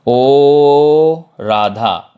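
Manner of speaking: very long, loud "ओ" before "Radha"; calling from afar. Shown as a demonstration. Teacher shows how to call someone standing far away, long loud hail. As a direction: stretch "ओ" out loudly to reach someone distant. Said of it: "दूर खड़े व्यक्ति को पुकारने के लिए".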